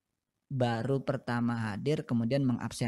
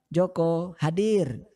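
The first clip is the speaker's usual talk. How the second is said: higher, slow, calling the name out; teacher calling roll; 4 semitones higher, 3 dB louder, slower than usual. Shown as a demonstration. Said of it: "dalam bahasa Indonesia". (guru memanggil absen dengan lambat)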